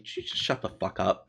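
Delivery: low, softened deadpan; understated sardonic put-down; dry, sardonic. Acted. Someone answering an overly serious person, sardonically. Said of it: "somebody dryly and sardonically".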